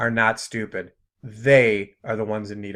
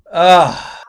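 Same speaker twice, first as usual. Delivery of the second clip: loud, higher groan of disgust; exasperated groan; 5 semitones higher, 9 dB louder than usual. Real, not acted. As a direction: let out a fed-up "ugh". Exasperated at her teaching.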